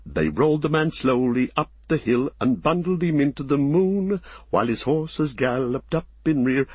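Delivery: lilting comic poem; rhythmic verse recitation. A narrator's reading. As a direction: recite a merry tavern rhyme in steady metre.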